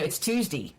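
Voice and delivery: "Tuesday" said loosely, ending in "dee"; don't enunciate "Tuesday", Baltimore style; unenunciated Baltimore dialect. Shown as a demonstration. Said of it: "it's not enunciating".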